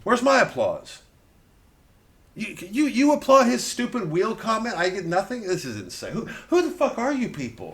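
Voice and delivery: high, loud, outraged; indignant outburst. Real, not acted. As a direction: demand applause in mock outrage.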